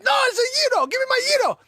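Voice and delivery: very high, loud mock protest; yelling back. Robbed customer correcting Spider Mark, high mock yelling. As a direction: imitate a man shrieking a correction. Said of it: "He's yelling back at me".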